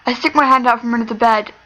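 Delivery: ordinary delivery, nothing marked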